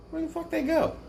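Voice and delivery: high squeaky mock outrage; high-pitched mock complaint; mock indignant squeak. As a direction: squeak it out in disbelief.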